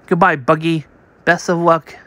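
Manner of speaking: ordinary delivery, nothing marked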